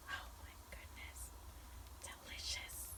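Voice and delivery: hushed whisper, savoring. Acted. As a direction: whisper in delight. Whispering over sizzling griddle.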